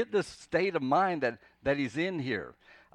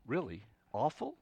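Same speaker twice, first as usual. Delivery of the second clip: skeptical questioning; 3 dB quieter than usual. Acted. Preacher answering the extreme accusation, openly doubtful. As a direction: query it, "Awful" rising sharply in doubt.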